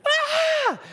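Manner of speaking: very high falsetto mock scream; hammed-up high scream from upstairs; imitated scream. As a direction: shriek like a wife who has spotted a bug. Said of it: "we hear her scream".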